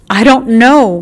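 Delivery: usual speaking voice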